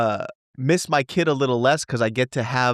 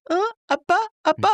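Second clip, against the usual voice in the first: put-on much higher baby voice calling dad; toddler voice; faster than usual. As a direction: imitate a little boy tugging at you. Imitating toddler son.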